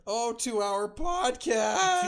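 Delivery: drawn-out shocked cry, high and slow; mock gasp. Real, not acted. Cohost reacting to a two-hour podcast with a drawn-out gasp. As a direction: gasp it out slowly in mock horror.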